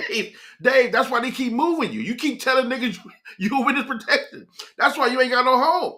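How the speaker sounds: high-pitched incredulous scolding; amused exasperation. Retold or imitated. Telling Dave why he keeps getting moved, amused exasperation.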